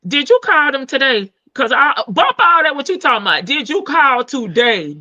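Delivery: loud, high, pushing the question; loud insistent. Real, not acted. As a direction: cut in loudly and demand an answer. Insists over the chatter.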